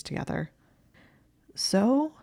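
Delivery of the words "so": high, rising strained question. A narrator's reading. Hermione presses Malfoy for an answer, strained. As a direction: let it climb, strained and tight. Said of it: "said in a strained voice".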